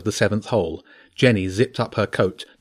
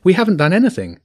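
high, louder protest; pleading; 8 semitones higher, 5 dB louder, faster than usual. A narrator's reading. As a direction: plead innocence urgently. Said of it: "Jenny pleaded".

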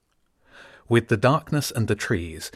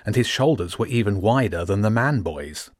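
ordinary delivery

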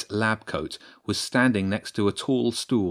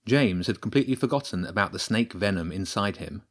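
plain reading voice

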